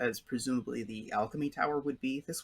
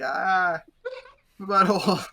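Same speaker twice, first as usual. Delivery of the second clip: high, loud wailing in fake agony; hammed-up anguished yelling; mock pain; 7 semitones higher, 8 dB louder than usual. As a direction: cry out like a cartoon man writhing on pavement. Same comic man in pain.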